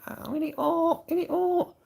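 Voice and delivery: high squeaky mock moan about the heat; mock whinging. Mocking English folk moaning about the heat, high squeaky whine. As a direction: whine it up in a silly high voice like a fed-up Brit. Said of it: "they'll be whinging".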